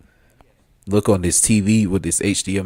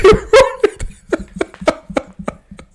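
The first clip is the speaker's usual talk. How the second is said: high, wheezing laughter; real wheezy, high cackle; helpless laughing fit; 8 dB louder than usual. Real, not acted. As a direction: laugh high until the breath runs out. Cracks up at his impression.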